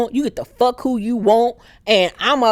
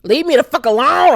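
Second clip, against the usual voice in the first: louder fed-up snap; irritated king; 3 semitones higher, 6 dB louder than usual. Retold or imitated. Viserys to Alicent, loud annoyed brush-off. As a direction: shove her off loudly. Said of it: "He like".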